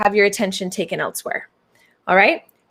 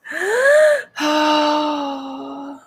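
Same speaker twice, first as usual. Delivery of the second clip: gasp; 6 semitones higher than usual. Acted. Sudden dramatic gasp.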